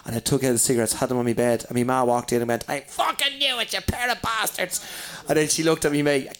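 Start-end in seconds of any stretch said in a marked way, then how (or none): 2.7-4.8 high, reined-in furious ma; mock angry mother. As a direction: imitate an outraged mum in a high, softer voice. Mother catches boys with cigarettes.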